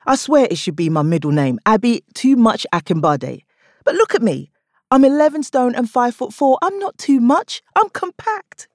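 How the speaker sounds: punchy comic delivery to the crowd; stand-up bit. Acted. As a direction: sell the joke to the room. Stand-up routine within the story.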